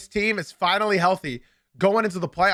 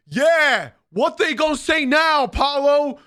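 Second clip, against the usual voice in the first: gloating fan; 7 semitones higher, 4 dB louder, slower than usual. Retold or imitated. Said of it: "my one Magic fan in my Discord ... saying".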